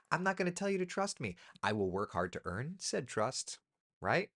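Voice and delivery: ordinary delivery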